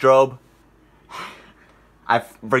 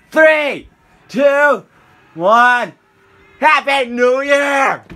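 shouted countdown, building higher; new year countdown crowd; 13 semitones higher, 6 dB louder, slower than usual. Real, not acted. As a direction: yell the last seconds to midnight.

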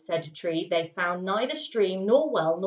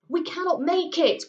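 higher, desperate cry of a man losing hope; cried out; 9 semitones higher than usual. A narrator's reading. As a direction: blurt it out as the shadows close in. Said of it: "cried Ulf Sigfusson".

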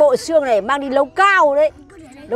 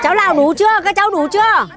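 higher-pitched call to a group; 7 semitones higher than usual. Real, not acted. Calls to the group of children.

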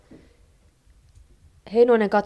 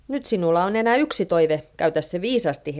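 ordinary delivery

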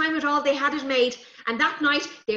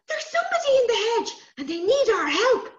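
high, fast urgent voice; 10 semitones higher, 4 dB louder than usual. Acted. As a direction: rush it out, worried. Urgent child.

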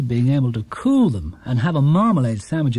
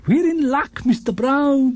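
put-on much higher, eager old man's burst of delight; pointing out a lucky find, thrilled; excited exclaim; 10 semitones higher, slower than usual. A narrator's reading. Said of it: "he exclaimed".

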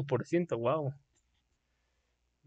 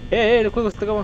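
sudden higher, louder shout; alarmed shout; 7 semitones higher, 3 dB louder, faster than usual. Real, not acted. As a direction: yelp at the threat. Game alarm, sudden danger.

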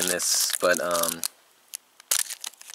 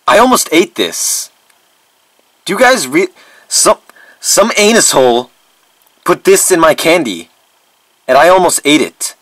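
loud, raised-pitch indignation; outraged disbelief; 6 semitones higher, 10 dB louder than usual. Acted. Vlogger showing viewers a razor blade in the candy, outraged disbelief. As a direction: protest loudly, appalled at the danger.

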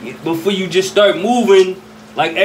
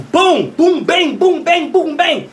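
imitated gunshots; 9 semitones higher, slower than usual. Imitating gunfire.

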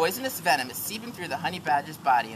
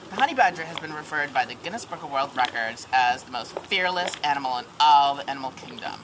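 ordinary delivery

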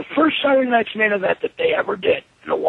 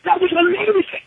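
excited network guy; 7 semitones higher than usual. Retold or imitated. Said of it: "they're like".

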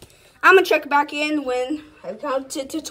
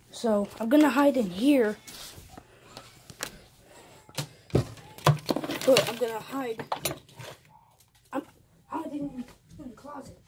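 whispering while hiding; 5 semitones lower, 11 dB quieter, faster than usual. Real, not acted. Hiding in closet.

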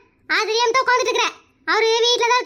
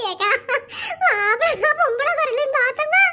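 usual speaking voice

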